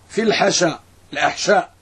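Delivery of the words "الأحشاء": ordinary talk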